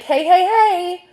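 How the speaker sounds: giggling through the hey, hey, hey; cracking up mid-line; real laugh breaking the ghost's words. Real, not acted. Ghost line broken by hiccup laughter.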